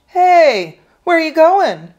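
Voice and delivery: high, loud taunting call; scratchy taunt. Acted. Bully calling after a fleeing kid, scratchy and taunting. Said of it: "The voice was scratchy and taunting".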